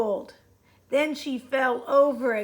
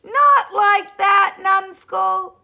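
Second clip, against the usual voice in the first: loud, slow, high scolding; exasperated insult; 7 semitones higher, 9 dB louder, slower than usual. A narrator's reading. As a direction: scold the clumsy eraser. Irritated objection, repeated.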